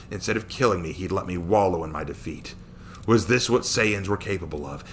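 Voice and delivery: ordinary delivery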